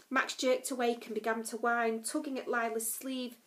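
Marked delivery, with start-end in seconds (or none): none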